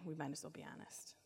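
a confiding whisper. Real, not acted. Preacher confiding to the congregation in a whisper. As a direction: let them in on a secret.

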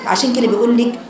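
usual speaking voice

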